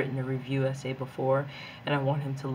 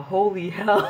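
laughing; 5 semitones higher, 5 dB louder than usual. Real, not acted. Laughing at her own hype.

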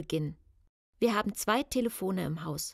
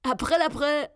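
high, loud prankster's taunt; boyish, triumphant high call; gleeful burst; 7 semitones higher, 7 dB louder than usual. A narrator's reading. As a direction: burst out with the April fool reveal. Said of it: "prustete mit Svantes unverstellter Stimme hervor".

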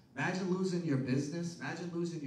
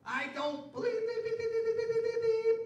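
high beeping swear sounds in place of curses; cartoon censor beeps for a cursing rant; mock bleeped cursing; 4 dB louder than usual. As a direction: squeal out the censored swear words. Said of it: "start cursing and swearing".

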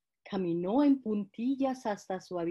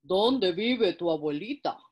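wolf voice; 2 semitones lower, 3 dB louder than usual. Acted. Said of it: "preguntó el lobo".

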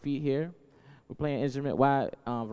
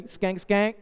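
call; 6 semitones higher, 4 dB louder than usual. Acted. Calling to right side of crowd.

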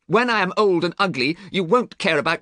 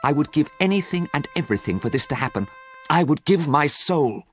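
from hushed grief up to a pleading wish; sad hush, building; 6 semitones higher than usual. A narrator's reading. Young man wishes the portrait aged instead, hushed then fervent. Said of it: "he whispered".

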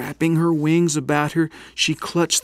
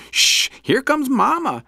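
hissed warning; 14 semitones higher, 3 dB louder than usual. Acted. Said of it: "hissed Pip".

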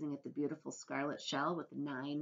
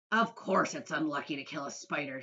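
shout; 7 dB louder than usual. A narrator's reading. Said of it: "shouted the centipede".

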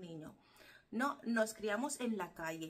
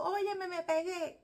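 high, slow triumphant shout; imitating a street domino yell; domino player's shout; 8 semitones higher than usual. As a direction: call out the win to the whole block. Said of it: "que tú escuchas ese grito".

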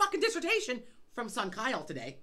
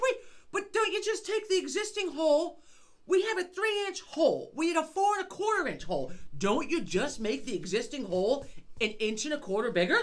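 higher, louder, laughing; laughing, incredulous ranting; 7 semitones higher, 4 dB louder than usual. Retold or imitated. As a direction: pile on the disbelief. Incredulous rant at the coring guy.

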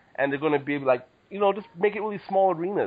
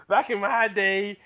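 put-on slow, higher old-timer voice; old person voice; 4 semitones higher, slower than usual. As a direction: draw it out like a grandpa. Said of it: "start it out in the old person voice".

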